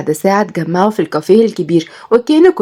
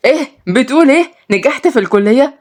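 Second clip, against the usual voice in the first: higher-pitched, rising astonished question; feigned surprise; 6 semitones higher than usual. Pretend. Mohamed faking a phone call, astonished question. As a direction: act amazed at the news on the phone.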